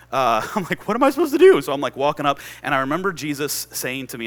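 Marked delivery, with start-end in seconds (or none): 0.9-1.6 high, loud bewildered outburst; mock helpless protest. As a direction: throw up a helpless, comic plea. Acted panic at being put on spot.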